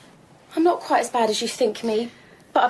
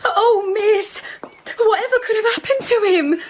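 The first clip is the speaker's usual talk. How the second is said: higher, crying plea for her husband; distraught wife on the verge of tears; tearful distress; 7 semitones higher than usual. Acted. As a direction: sob out the fear for him. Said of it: "She's in a dreadful state".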